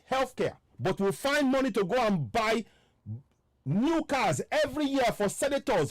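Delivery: high, heated political tirade; real indignation, pitch climbing sharply; real rising anger. Real, not acted. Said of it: "Man, I'm getting angry now".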